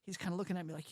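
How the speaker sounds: usual speaking voice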